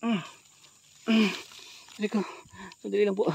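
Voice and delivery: ordinary talk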